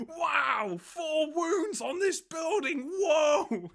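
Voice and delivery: high, loud hyped-up sportscaster, slow on the wounds; hyped announcer. Retold or imitated. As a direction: oversell it like a hype announcer. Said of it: "like, an announcer going".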